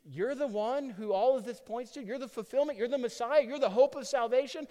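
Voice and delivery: rising, astonished questions; acted awestruck onlooker piecing it together; amazed realization. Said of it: "But then others are like".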